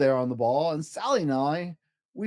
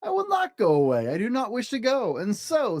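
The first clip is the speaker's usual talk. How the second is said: higher, defiant; stubborn cat; 6 semitones higher than usual. Acted. Cat refusing to leave, high and defiant. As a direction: refuse with a grin.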